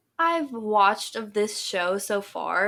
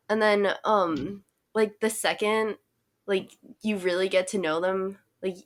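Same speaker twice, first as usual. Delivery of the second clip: normal talking voice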